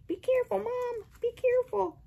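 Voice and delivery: high soft cat voice warning mom; voicing the cat.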